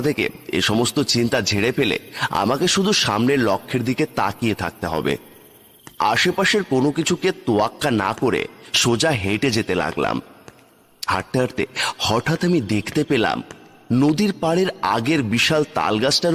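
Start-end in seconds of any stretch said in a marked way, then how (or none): none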